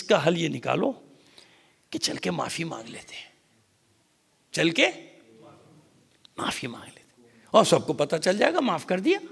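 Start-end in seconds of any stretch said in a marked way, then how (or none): 1.9-7.3 conspiring hush. Acted. Plotters whisper their scheme.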